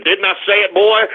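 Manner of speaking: usual speaking voice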